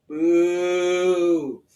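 loud mock boo. A narrator's reading. Child in synagogue booing Haman, loud. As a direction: boo the villain with the crowd.

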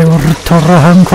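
high, loud hype callout; screaming attack name. Real, not acted. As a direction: shout the special like a battle cry. Calling out special weapon. (技名を叫ぶ)

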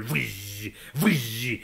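soft, low whooshing gusts; howling wind noise. Acted. Storyteller imitates wind after Scott's last call, soft whoosh. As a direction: blow hushed wind sounds. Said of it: "Și vântul".

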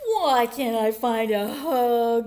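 loud, slow, drawn-out complaint; storybook cry of frustration; frustrated wail. A narrator's reading. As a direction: wail it out as the hugless bear. Frustrated bear in read-aloud.

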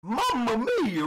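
mock Italian. Worker greets customer as paisano.

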